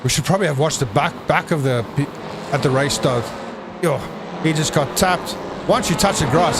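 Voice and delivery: ordinary talk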